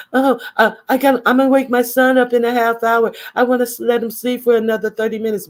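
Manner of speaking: high, fast flustered mimicry; hammed-up rushed high voice; mocking quote. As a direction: rattle it off like a distracted mom. Mocking M U's excuse.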